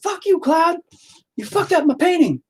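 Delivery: very high, hammed-up angry screech; mock fury. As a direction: screech it in pretend outrage. Acted quarrel with the cloud.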